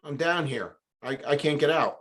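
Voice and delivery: stuck and hollering upward, pleading; calling up for help. Retold or imitated. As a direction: hail a friend walking past above. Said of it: "He goes up".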